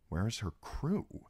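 soft, musing question; quiet private puzzlement; wondering. A narrator's reading. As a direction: wonder it softly to herself. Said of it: "she wondered".

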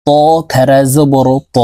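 ত said heavy and full-mouthed, drawn a full alif; slow drill recitation. Shown as a demonstration. Teacher showing viewers the heavy ত, slowly. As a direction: make ত thick and long. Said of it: "এই ত কে মোটা করে উচ্চারণ করতে হবে".

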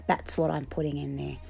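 ordinary delivery

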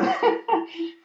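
a high burst of real laughter. Real, not acted. A preacher laughing at his sneeze joke. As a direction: laugh at your own joke.

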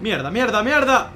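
rising panicked cursing; panicked shouting. Real, not acted. Panics in game.

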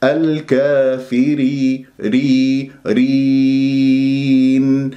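الكافرين very slow, final ee held six beats; no nasal yaa. Shown as a demonstration. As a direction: sustain رين cleanly, nasality only on n. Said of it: "ولا أجعل غنة في هذه الياء".

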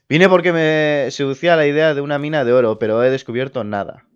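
usual speaking voice

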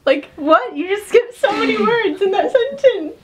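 high-pitched, laughing incredulity; exasperated disbelief breaking into a laugh; amused frustration. Acted. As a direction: protest in mock outrage, pitch climbing. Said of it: "it just frustrated me on a grammatical standpoint".

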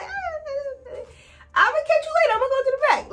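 high, flustered laughing exit; awkward escape. Retold or imitated. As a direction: laugh it off and slip away. Escaping the conversation, acted.

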